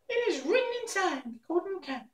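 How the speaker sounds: very high squeaky mouse queen; squeaky little voice. A narrator's reading. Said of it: "asked in her squeaky little voice".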